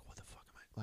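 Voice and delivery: whispered under the breath; bewildered private whisper; retold inner thought. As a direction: whisper it like a thought kept to yourself. Retold silent thought while waiting.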